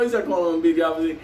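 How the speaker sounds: ordinary delivery, nothing marked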